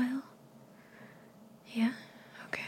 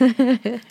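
short teasing laugh; 4 semitones higher, 7 dB louder than usual. Acted.

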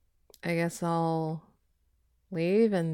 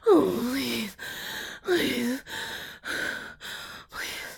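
fading plea; 2 semitones higher, 3 dB quieter, much slower than usual. Acted. Pleading fading out.

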